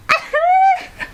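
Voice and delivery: shrieking giggle; high squealing laugh. Real, not acted. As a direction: squeal with delight. Reacting to the scene.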